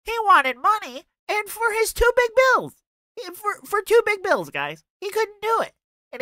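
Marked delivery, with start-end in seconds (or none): 0.0-4.5 high, nasally mocking take-off of the streamer; high nasal voice impression. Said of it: "he sounded like this the whole time". 5.0-5.7 high, nasally mockery; high nasal voice impression. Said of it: "he sounded like this the whole time".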